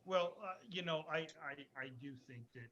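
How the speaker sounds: faint, far-off mic with hiss; barely audible through a failing microphone; quiet voice loud hiss. Real, not acted. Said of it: "your volume has gone way down".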